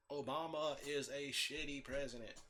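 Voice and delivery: ordinary talk, nothing marked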